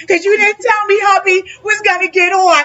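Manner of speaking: high, bright-pitched gush; excited gushing. Real, not acted. Host gushes at her friend's husband, excited. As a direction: gush over the surprise guest.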